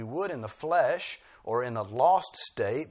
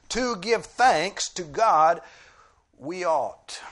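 slow, higher and louder, leaning on "To give thanks"; emphatic reading; 7 semitones higher, 9 dB louder, slower than usual. Shown as a demonstration. Preacher reading the verse literally to show emphasis, slow and emphatic. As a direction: proclaim the literal Greek order, slowly. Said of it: "translated literally, begins".

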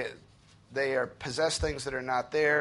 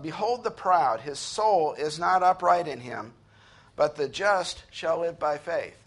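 slow, louder, weighty reading of the verse; solemn scripture reading; 4 dB louder than usual. Acted. Preacher reading the key verse aloud to his congregation, slow and solemn. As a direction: lay each phrase down slowly like holy writ.

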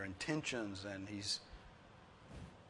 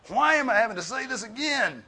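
much higher, loud fed-up parent; exasperated parent; 11 semitones higher, 12 dB louder than usual. Retold or imitated. Preacher mimicking a fed-up parent, high and loud. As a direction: really let the exasperation out. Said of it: "You ever said that to your children".

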